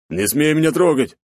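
loud menacing warning. A narrator's reading. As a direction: threaten him, loud and high. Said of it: "грозно посмотрел он".

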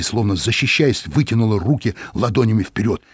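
usual speaking voice

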